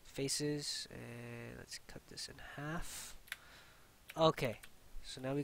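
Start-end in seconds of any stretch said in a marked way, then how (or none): 0.9-3.1 slow whispered aside; whispering. Real, not acted. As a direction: whisper it slowly to yourself. Trying the cut again.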